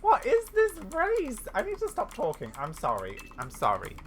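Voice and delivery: usual speaking voice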